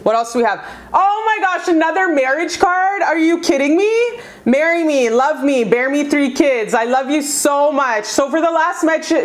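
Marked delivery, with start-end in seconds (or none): 0.9-4.2 high, squealing amazement; can't believe the card she just pulled; delighted disbelief. Real, not acted. Tarot reader amazed at yet another marriage card, high and delighted. 4.4-8.1 eager proposal. Acted. Voicing the ex's message.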